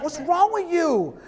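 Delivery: high, stretched-out bafflement; hammed-up dad at wit's end; mock exasperation.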